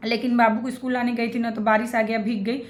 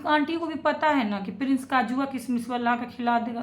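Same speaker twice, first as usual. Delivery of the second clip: ordinary delivery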